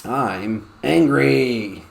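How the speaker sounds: slow, drawn-out pupil reply; slow student answer. Retold or imitated. Girl answering the teacher, slow. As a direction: stretch it like a shy pupil. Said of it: "The girl says".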